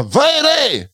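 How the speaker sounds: mock the comedian's catchphrase; Adde Malmberg impression. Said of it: "Han bara".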